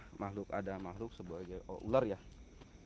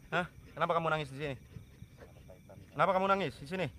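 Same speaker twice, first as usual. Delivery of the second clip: higher and louder, pressing hard; loud questioning; 9 semitones higher, 7 dB louder, faster than usual. Real, not acted. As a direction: interrogate the spirit at the well. Questioning a spirit at a tree. (bertanya lantang dan mendesak)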